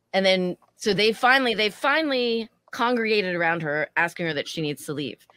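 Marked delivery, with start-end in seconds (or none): none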